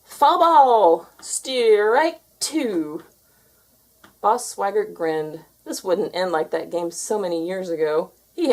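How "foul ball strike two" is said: umpire call. A narrator's reading. Umpire calling the pitch.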